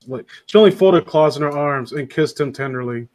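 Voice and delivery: ordinary talk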